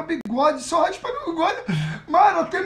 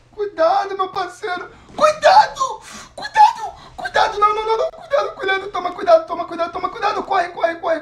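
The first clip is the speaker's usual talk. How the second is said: frantic shouting with laughter; being chased; 3 semitones higher, 4 dB louder than usual. Real, not acted. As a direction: yell warnings in a panic. Frantic yelling at a match.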